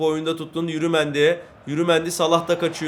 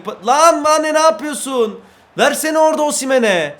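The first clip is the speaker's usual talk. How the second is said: high, loud shout at the player; loud angry shout at the screen; frustrated yell; 10 semitones higher, 6 dB louder than usual. Real, not acted. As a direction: yell at your striker to pass. Yelling at players in game.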